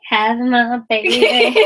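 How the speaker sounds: slow, laughing tease; laughing mock line. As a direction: say it through a laugh as a joke. Joking flirt, laughing.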